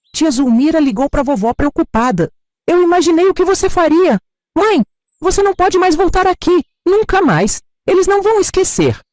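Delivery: a grown son warning his mother, firm and urgent; son's urgent warning. Acted. Narradora dá voz ao filho Jorge. (Jorge avisa a mãe, voz mais aguda e alta, urgente)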